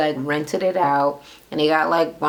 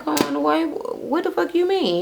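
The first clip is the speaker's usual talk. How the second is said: much higher, shocked mother's voice; 11 semitones higher than usual. Retold or imitated. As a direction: play the stunned mom, pitched way up. Mother shocked at son leaving.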